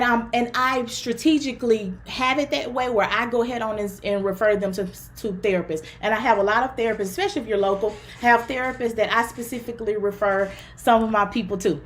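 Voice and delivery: ordinary delivery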